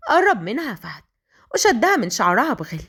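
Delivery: plain reading voice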